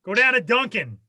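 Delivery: put-on Boston accent. Host riffs a Boston character for his cohost. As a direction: order Packy around like a Boston guy. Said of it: "now you're just trying to do, like, Casey Affleck".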